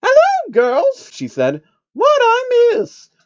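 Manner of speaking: very high, loud, posh-lady falsetto; chipper Rarity. Acted. Rarity greeting the mares, very high chipper falsetto. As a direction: sing it out glamorously.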